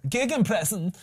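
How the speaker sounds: Gegenpressing said in a high, put-on German accent; German accent, hammed up for a laugh. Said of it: "Say it in a German accent".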